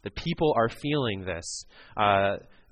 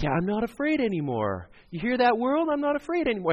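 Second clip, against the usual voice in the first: put-on much higher, rising into a triumphant movie-kid cry; Kevin from Home Alone; 12 semitones higher, 3 dB louder than usual. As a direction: burst out like a kid who just beat his fears. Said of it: "like Kevin in Home Alone".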